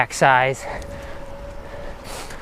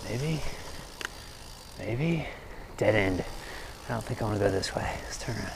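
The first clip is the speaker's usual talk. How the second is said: muttering to himself; 4 semitones lower, 15 dB quieter than usual. Real, not acted. Quiet unsure muttering to himself.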